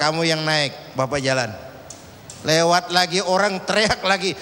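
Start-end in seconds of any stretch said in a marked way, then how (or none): 0.0-1.5 calm father. Acted. Father answers son.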